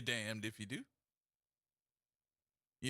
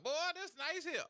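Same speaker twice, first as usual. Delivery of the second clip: country voice; 11 semitones higher than usual. Retold or imitated. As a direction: gush over the truck like a country boy. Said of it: "I did in my country voice".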